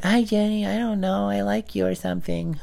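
put-on a nervous boy's mumbled confession; awkward kid. Awkward young self confessing.

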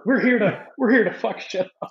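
high, pumped-up movie quote; Step Brothers quote. Retold or imitated. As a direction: shout it like a cocky movie hero. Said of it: "that scene in Step Brothers".

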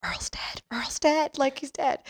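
urgent whisper rising into a high hiss; frantic whispering. Retold or imitated. Brother breaking news of a death to mom, frantic whisper. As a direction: imitate a panicked, hushed report. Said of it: "frantically whispering to my mom".

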